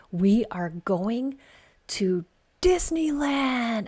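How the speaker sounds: slow, drawn-out, high announcement; mother announcing the trip to her sons, thrilled; excited reveal. Retold or imitated. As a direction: stretch it out like a big reveal. Revealing the surprise.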